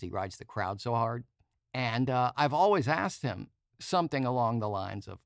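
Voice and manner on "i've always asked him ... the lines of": usual speaking voice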